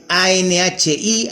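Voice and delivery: A N H I spelled letter by letter, slowly. Shown as a demonstration. Teacher to students spelling the word anhídrido slowly. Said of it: "Presten atención a la palabra anhídrido".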